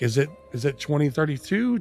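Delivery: normal talking voice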